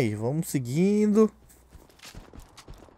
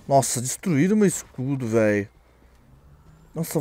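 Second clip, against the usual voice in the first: ordinary talk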